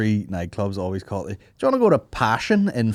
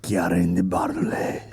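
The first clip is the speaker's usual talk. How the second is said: whispered Spanish ad voice; 4 semitones lower, 4 dB quieter than usual. Pretend. Perfume ad parody, whispered.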